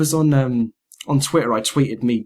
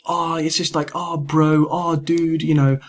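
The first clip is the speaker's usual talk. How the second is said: raised-pitch dude-bro imitation; mock bro chatter; 5 semitones higher, slower than usual. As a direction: gush like a cheesy gamer bro.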